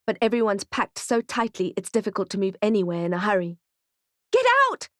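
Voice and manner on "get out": much higher, louder scream; woman screaming at Kate in panic. A narrator's reading. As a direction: scream it right in her face. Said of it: "screams a woman".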